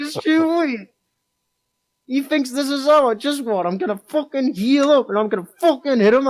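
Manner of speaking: hammed-up drunken fury in a high voice; raging threats. Retold raging threat in hospital.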